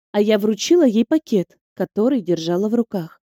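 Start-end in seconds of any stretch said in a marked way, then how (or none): none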